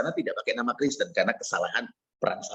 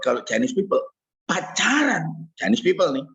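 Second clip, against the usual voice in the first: ordinary delivery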